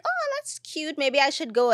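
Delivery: a sudden perky lift as if spotting something cute; light surprise. Retold or imitated. Telling listeners how she reacted to the invite, perky high lift. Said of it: "Um, and I was like".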